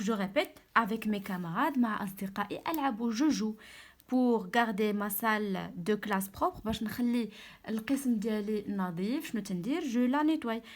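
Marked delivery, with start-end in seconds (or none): 4.1-6.6 slow, clear sentence reading; slow read-aloud. Shown as a demonstration. Teacher rereading the sentence to pupils, slow and clear. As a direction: read it slowly so pupils follow. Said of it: "teacher rereads the sentence".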